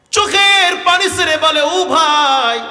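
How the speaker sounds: high, loud chanted narration; melodic waz preaching. Acted. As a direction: sing out the story at a high pitch. Tuneful sermon storytelling. (উঁচু সুরে ওয়াজ)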